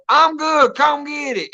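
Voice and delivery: high, hollered mom call; imitating mom hollering. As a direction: call it out like a mother. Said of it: "she hollers out".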